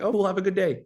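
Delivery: high-pitched, breezy brush-off reply; mock breezy acceptance. Man answering a polite rejection, high breezy acceptance.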